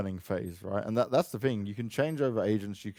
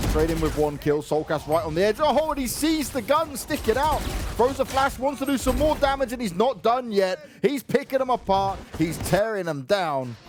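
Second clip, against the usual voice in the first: excited, higher play-by-play call; esports caster; 11 semitones higher, 6 dB louder than usual. Acted. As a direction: call the kills with rising hype. Live play-by-play of a fight.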